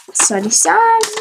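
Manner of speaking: high, loud, exasperated; high loud exclamation. Real, not acted. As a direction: throw the question out loudly. Sudden exclamation. (laut und hoch)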